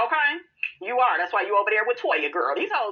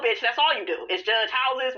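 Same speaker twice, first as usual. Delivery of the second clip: usual speaking voice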